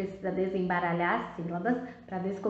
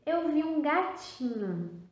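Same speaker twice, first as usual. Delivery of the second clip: "Eu vi um gatinho" with higher pitch; reading an example; 6 semitones higher than usual. Shown as a demonstration. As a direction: read the sentence aloud as an example. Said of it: "se eu escrevesse".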